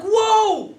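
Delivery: loud high whoa of awe; be blown away; awed whoa. Real, not acted. Teacher stunned by Yehuda's might, loud whoa.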